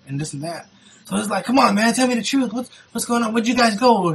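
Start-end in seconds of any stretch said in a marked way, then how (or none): 1.4-4.1 mildly mad pleading, pitched up; getting mad. Retold or imitated. Niece's boyfriend demands the truth, getting mad. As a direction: imitate a worked-up boyfriend pressing for answers. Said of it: "getting mad or whatever".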